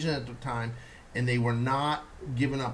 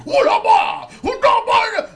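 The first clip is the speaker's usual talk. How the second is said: full-blast mock roar, pitched way up; exaggerated Japanese impression; 14 dB louder than usual. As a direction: bellow it like an over-the-top show of power. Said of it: "his face and the shit".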